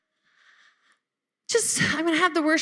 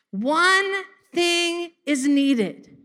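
solemn emphasis; 3 semitones higher, much slower than usual. Shown as a demonstration. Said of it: "slow solemn quote".

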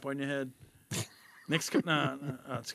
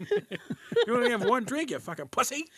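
rude waiter voice; 9 semitones higher than usual. Retold or imitated. Rude staff at Dick's.

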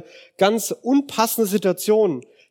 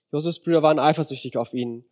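ordinary delivery

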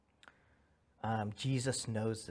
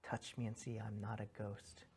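soft invitation; 10 dB quieter than usual. Acted. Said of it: "that's why he says".

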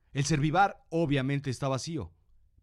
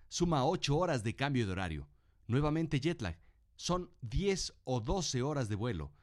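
normal talking voice

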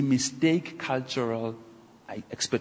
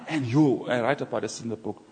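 ordinary talk, nothing marked

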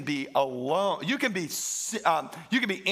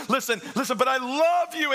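Jerry Maguire plea; 6 semitones higher, 3 dB louder than usual. Retold or imitated. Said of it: "he says".

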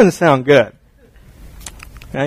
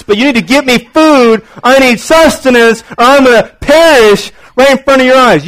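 put-on high, loud hungry-kid whine throughout; a son whining from the car seat for food; whiny starving kid; 10 semitones higher, 8 dB louder than usual. Pastor imitating his son begging for food in the car.

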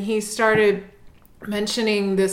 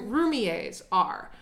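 ordinary delivery

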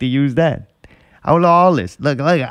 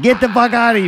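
mock angry yell; 9 semitones higher, faster than usual. Staff throwing diner out.

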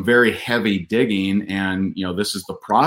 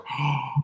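imitated gasp; 6 semitones higher, 8 dB quieter than usual. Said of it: "my wife is like".

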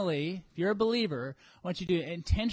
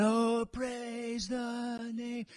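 slow, drawn-out, higher worshipful singing; mock worship; 4 semitones higher, 6 dB quieter, much slower than usual. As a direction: ham up the pious worship voice.

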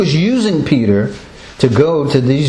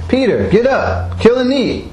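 higher-pitched mock command; lightly voiced divine command; 9 semitones higher than usual. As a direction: retell an order, pitched up and insistent. Voices God's repeated command to Peter.

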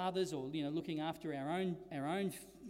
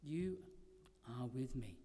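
softer and lower, "with" barely stressed; hushed repeat; 6 semitones lower, 6 dB quieter than usual. Acted. Preacher repeating the promise softly to the congregation, hushed. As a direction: let "You are with me" fall to a near hush. Quiet repeat after pause.

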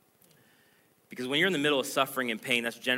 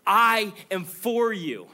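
high and loud, slow, falling on you; loud proclamation; 8 semitones higher, 7 dB louder, much slower than usual. Acted. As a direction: call out the promise with full force. God's promise, first take.

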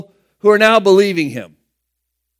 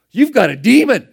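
raised, louder mock accusation; acted heated comeback, higher and louder; harsh retort; 5 semitones higher, 6 dB louder than usual. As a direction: throw it back harshly, pitched up. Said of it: "that's harsh language that we're using".

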